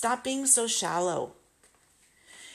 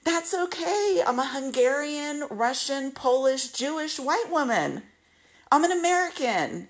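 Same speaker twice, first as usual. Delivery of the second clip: higher, eager listing of roots; eager reassurance; 5 semitones higher than usual. Retold or imitated. [name] listing her heritage to her friend, eager and high. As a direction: keep it bright and pleading. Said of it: "And I'm like".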